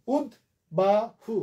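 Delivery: উদ্বাহু as ud, ba, hu with gaps; syllable by syllable. Shown as a demonstration. Teacher counting three syllables, slow and separated. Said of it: "তিনটা".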